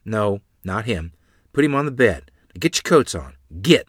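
a touch of an angry mother. A narrator's reading. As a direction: snap the orders at the children. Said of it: "Mother said, angrily".

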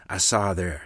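ordinary delivery, nothing marked

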